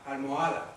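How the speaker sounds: ALMOHADA with the H sounded; Arabic H. Shown as a demonstration. Teacher showing pupils a vanished sound, aspirated H in Almohada. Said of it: "hacéis la H arábiga".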